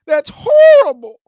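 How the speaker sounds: high, loud teary wail; fake-cry it like the girlfriend; loud, high mock sob of a shocked girlfriend; mock tearful. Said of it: "she was about to cry".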